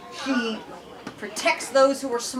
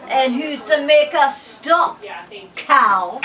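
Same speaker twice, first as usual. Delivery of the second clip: taunting bully; 6 dB louder, much slower than usual. Acted.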